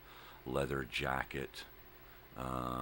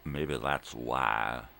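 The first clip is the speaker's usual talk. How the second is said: loud, slow, stretched WHY; drawn-out realization; 7 dB louder, much slower than usual. Pretend. Repeating the thought about shakes, loud drawn-out delivery. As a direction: hold "why" long and push it out loud.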